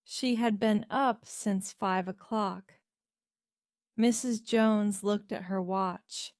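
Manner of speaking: ordinary delivery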